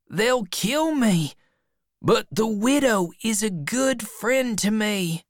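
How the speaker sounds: put-on high, anxious boy voice; pleading boy. A narrator's reading. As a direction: plead your case in a high voice. Frightened Huck explains his plea.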